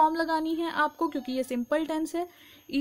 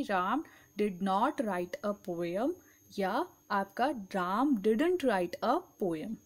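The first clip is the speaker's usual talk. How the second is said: slow dictation; 4 semitones lower, much slower than usual. Shown as a demonstration. Teacher dictating the English answer, very slow. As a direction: spell it out word by word for the class.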